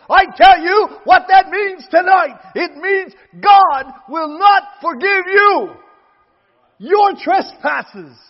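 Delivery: full-force revival preaching; fiery preaching. Real, not acted. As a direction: hammer the warning home to the congregation. Preaching climax.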